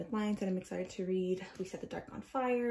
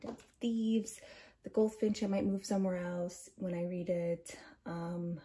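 ordinary delivery

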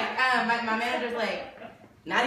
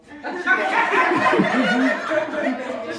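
hearty laugh, louder; 5 semitones higher, 8 dB louder than usual. Real, not acted. Telling of the doctor visit, loud hearty laugh. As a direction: let a big laugh out.

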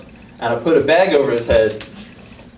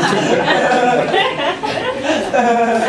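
long high laughter; laughing; 8 semitones higher than usual. Real, not acted. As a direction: crack up at yourself. Laughing at his own accent.

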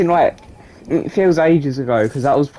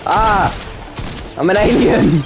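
higher, warped alien voice; 5 semitones higher, much slower than usual. Real, not acted. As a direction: shout like a distorted alien. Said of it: "Did you hear my voice".

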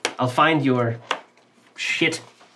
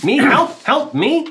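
high squeaky voice; 10 semitones higher than usual. Acted. Squeaky character voice.

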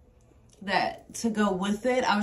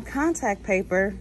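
ordinary talk, nothing marked

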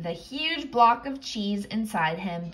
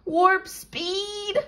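high parrot squawk; 10 semitones higher than usual. Acted. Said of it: "squawked the parrot".

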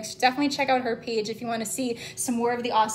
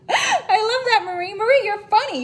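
laughing; 8 semitones higher, 5 dB louder than usual. Real, not acted. Laughing at a viewer's joke.

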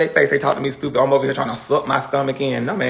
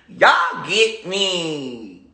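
drawn out; much slower than usual. Real, not acted. Stretched out to viewers.

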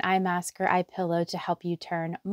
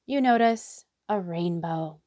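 guided meditation. Acted. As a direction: lead them gently into relaxation, letting each phrase settle. Leads a guided hypnotherapy meditation.

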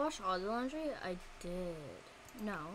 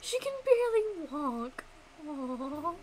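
adoring coo; 5 semitones higher than usual. Real, not acted. Gamer watching her little character barely walk, adoring coo. Cooing at wobbly game child.

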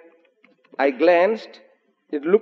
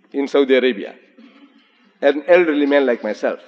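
ordinary delivery

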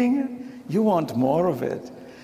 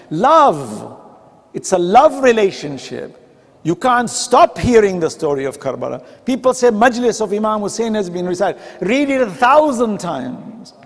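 louder, impassioned sermon delivery; fervent preaching; 2 semitones higher, 4 dB louder than usual. Acted. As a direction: preach it out with fire. Preaching at full force.

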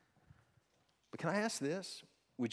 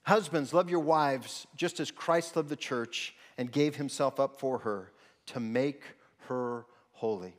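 loud, fervent pulpit delivery; rousing sermon voice; preaching at full force; 2 semitones higher, 7 dB louder than usual. Acted. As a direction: drive the points home to the men.

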